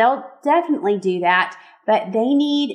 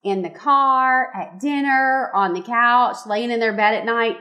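normal talking voice

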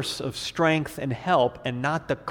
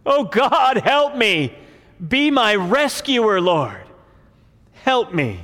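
loud, high, desperate plea to God; crying out; 8 semitones higher, 8 dB louder, slower than usual. Acted. David calling to the Lord from a cave, crying out. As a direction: cry out for rescue, pitched high and loud. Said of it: "he's crying out to the Lord".

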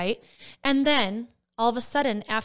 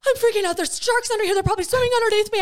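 much higher, louder, rushed panic; retold panic; 12 semitones higher, 6 dB louder, faster than usual. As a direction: blurt it out fast in mock terror. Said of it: "I was, like, freaking out".

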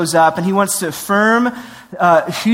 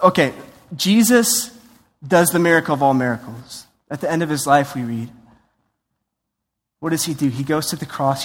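usual speaking voice

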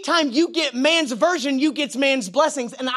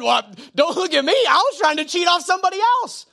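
put-on higher, louder defensive kid; mock defensive schoolboy; voiced classmate; 6 semitones higher, 3 dB louder than usual. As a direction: play the caught cheater shrugging it off.

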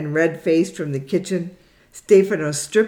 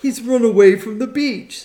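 excited teasing; 7 semitones higher, 5 dB louder than usual. Acted. Sister teasing, higher louder.

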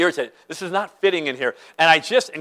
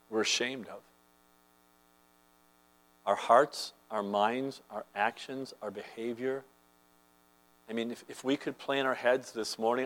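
lower, softer sermon voice; hushed confession; 7 semitones lower, 8 dB quieter than usual. Acted. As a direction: draw them into quiet shame before God. Quiet confession section of sermon.

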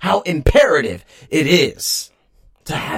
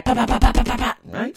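firecracker pops; 9 semitones higher than usual. Retold or imitated. Imitating firecrackers.

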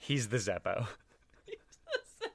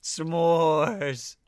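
excited; 7 semitones higher, 10 dB louder than usual. Real, not acted. Excited exclaim.

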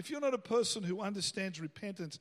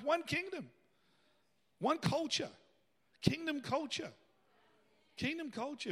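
raised, urgent pulpit delivery; full-force preaching; 5 semitones higher, 5 dB louder than usual. Real, not acted. Preacher urging the congregation to repent quickly, impassioned preaching. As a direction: preach it high and loud, easing in volume at the end.

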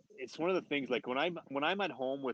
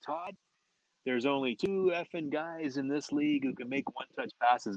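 retold coach yelling; 4 semitones higher than usual. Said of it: "Pat starts yelling at me".

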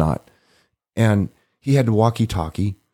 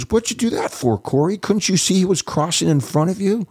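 much higher, fake-innocent tease; mock innocent scolding; 11 semitones higher than usual. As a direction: play dumb and scold Corey in a high voice. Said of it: "Alan looks at me and goes".

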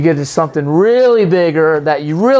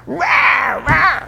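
mock shout; 8 semitones higher than usual. Mock attacker shouting at believer.

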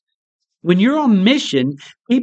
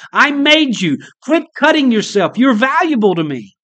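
half-shouted plea, pitched up high; God screaming; 6 semitones higher than usual. Retold or imitated. Preacher voicing God to hurting listeners, high raised plea. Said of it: "God screams from the Bible".

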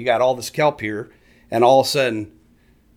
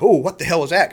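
higher, louder mock surprise; startled fish; 5 semitones higher, 4 dB louder than usual. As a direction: voice a fish caught off guard. Voices startled bait fish.

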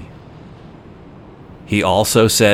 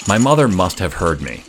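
ordinary talk, nothing marked

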